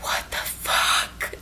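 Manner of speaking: slow, whispered disbelief, acted out; shocked whisper. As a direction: whisper it, stretching each word. Retold shocked friend.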